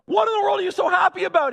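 high, loud astonished question; incredulous shout. Acted. Father asks son jumping in manure, incredulous shout. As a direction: call it out in amazed disbelief.

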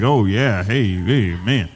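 low, half-hearted mock agreement; imitated congregation response. Preacher mimics everyone's reaction, low and lukewarm. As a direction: drop low to imitate an underwhelmed crowd. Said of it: "Everyone was like".

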